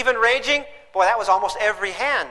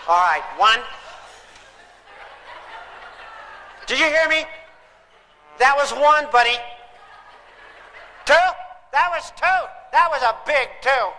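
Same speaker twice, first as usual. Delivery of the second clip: mock threatening countdown; 4 semitones higher than usual. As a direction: play the exasperated parent stretching the count. Parent counting to threaten child.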